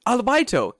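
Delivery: high, loud "albaito"; mock insistence. Mocking the teacher's insistence, "albaito" high and loud. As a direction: punch out "albaito" like an obstinate caricature. Mocking word game, alternating with names.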